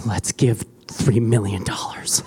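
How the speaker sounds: hushed whisper, slow; whispered kids' idea. Retold or imitated. Kids whispering a huge offering goal to mom. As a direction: whisper it like a secret wish. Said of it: "And then they were like".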